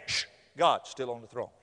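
low, settled reassurance after a shush; shush, then assure them in a low voice; calming hush. Acted. Hushing the worrier.

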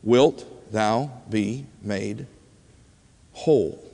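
slow, soft, word by word; solemn question. Retold or imitated. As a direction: ask it low-key and grave, pausing between words. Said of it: "So the question still comes back".

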